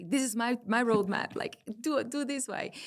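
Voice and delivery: a hammed-up bossy little kid; mock demanding child. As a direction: tell God how it's going to go. Bossy prayer to God, retold.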